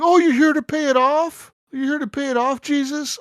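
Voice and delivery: high mocking voice of the bank manager; whiny high mimic of her. Imitating the bank manager to friends, high and mocking. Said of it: "She's like".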